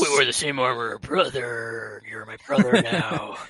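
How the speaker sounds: low, hammed-up comic brother voice; mock brotherly voice.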